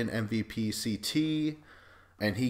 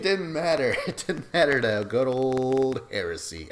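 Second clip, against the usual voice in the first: laughing; 3 semitones higher, 5 dB louder than usual. Real, not acted. Laughing while telling.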